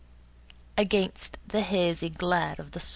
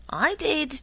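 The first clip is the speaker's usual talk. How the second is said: a higher, louder boast; eager declaration; 7 semitones higher, 5 dB louder than usual. A narrator's reading. Doodle claims he heard the rain frog, eager and loud. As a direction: blurt it out proudly. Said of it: "declared Doodle".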